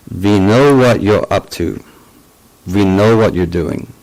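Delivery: imitating a German-accented caller, firm. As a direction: stretch KNOW in a mock German accent. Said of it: "with a German accent".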